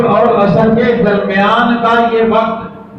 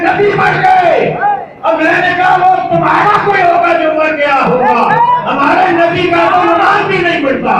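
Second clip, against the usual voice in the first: raised, fast retort from the pulpit; fiery preaching; 6 semitones higher, faster than usual. Acted. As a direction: fire back at the doubters.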